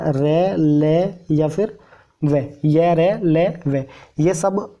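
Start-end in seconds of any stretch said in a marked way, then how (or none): 0.1-1.8 रे and ले stretched out slowly; slow sounded-out syllables. Shown as a demonstration. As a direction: drag out रे, ले one by one. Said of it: "किसी शब्द के बीच में जब ये".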